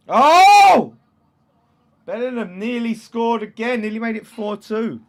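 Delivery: very high, very loud "Oh!" then a loud high run; near-miss shriek. Real, not acted. Streamer shrieking as Bellingham nearly scores, excited. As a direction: jump up at the chance.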